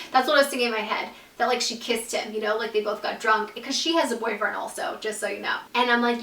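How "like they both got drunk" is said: ordinary delivery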